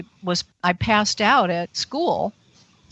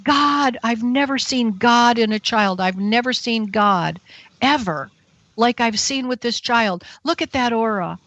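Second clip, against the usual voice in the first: raised pitch, amazed psychic exclaiming; imitated amazement, insistent and raised; awestruck retold outcry; 3 semitones higher than usual. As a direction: exclaim high in astonishment, as if retelling it. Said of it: "and then she goes".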